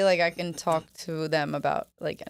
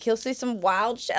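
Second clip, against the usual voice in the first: ordinary talk, nothing marked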